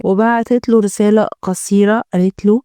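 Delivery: ordinary delivery, nothing marked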